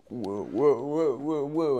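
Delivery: loud, rising string of startled whoas; mock alarm. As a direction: act out being shoved around. Acting out being pushed around.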